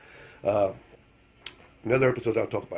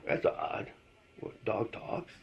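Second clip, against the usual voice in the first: hushed, puzzled whisper; soft, baffled aside to himself; 8 dB quieter, much slower than usual. Retold or imitated. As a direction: murmur it to yourself in disbelief. Puzzled reaction to the ad.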